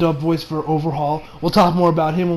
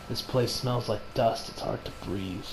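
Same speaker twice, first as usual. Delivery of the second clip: cold villain voice; 7 semitones lower, 14 dB quieter than usual. Retold or imitated. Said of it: "Overhaul mentions".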